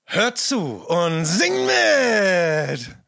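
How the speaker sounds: big slow hammed-up call to sing; drawn-out loud announcement. Shown as a demonstration. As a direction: call it out like a showman. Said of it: "Okay, nochmal, nochmal".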